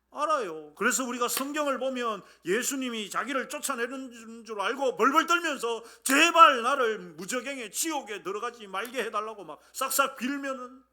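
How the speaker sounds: impassioned pulpit retelling of desperate begging; preaching at full force. Real, not acted. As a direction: drive the story forward with intense emphasis.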